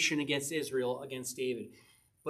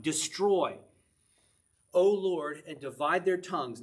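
louder, pleading psalm read; a preacher voicing the psalmist's plea, louder; scripture reading; 5 dB louder, slower than usual. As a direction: call on the Lord like the psalmist.

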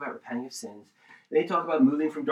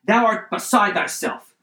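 loud, raised, stately interruption; 4 semitones higher, 7 dB louder than usual. Acted. Preacher acting Festus interrupting Paul, loud voice. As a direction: cut in loudly with a high, forceful rebuke. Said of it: "Festus said with a loud voice".

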